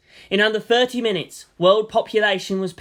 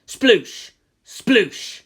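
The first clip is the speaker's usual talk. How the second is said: wet splat sound; 4 semitones higher, 5 dB louder than usual. A narrator's reading. People exploding into goop.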